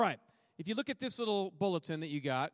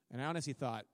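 usual speaking voice

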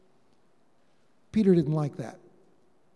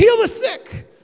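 high, loud, drawn-out battle cry; rallying shout; 13 semitones higher, 7 dB louder than usual. Retold or imitated. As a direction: shout it like an eager cheer. Disciples' eager rallying cry.

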